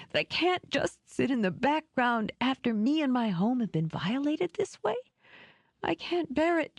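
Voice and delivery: high, breathy, tearful plea; tearful pleading. Acted. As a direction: plead through tears, voice breaking. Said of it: "her voice thickened and shook".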